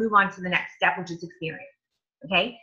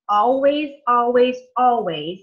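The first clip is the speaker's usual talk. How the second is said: each always louder and punched; emphatic repetition; 2 semitones higher, 5 dB louder, slower than usual. Real, not acted. Coach drilling team members on chat etiquette, emphatic. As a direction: hammer the word always three times. Coach insisting on a key rule.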